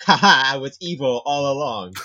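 loud, high gloating villain laugh-line; evil villain gloat. Acted. The traitor god springs the box trap, loud gloating villain. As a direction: crow it like a cartoon villain who planned it all.

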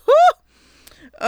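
high, loud hammed-up whoop; swooning whoo. Gushing over John Boyega, a high loud mock swoon. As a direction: whoop like fanning herself.

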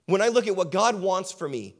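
normal talking voice

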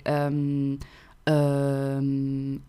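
um drawn out low as a French "euhm"; French hesitation sound. Shown as a demonstration. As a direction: hold a long, searching um, French style. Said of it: "sound that we make when we are not sure".

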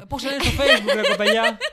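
high and loud, laughing through the words; laughing retelling. Retold or imitated. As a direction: laugh while you quote yourself. Retells his own awkward line, laughing.

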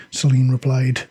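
usual speaking voice

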